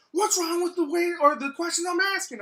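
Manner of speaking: imitating an indignant customer, high and loud. Customer confronting staff about her questions, loudly indignant.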